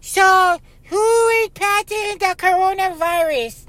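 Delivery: high, squeaky mock question from the audience; mocking listener voice. Host mimics podcast listeners asking in a high squeaky voice. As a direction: play a clueless listener asking. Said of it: "you're going".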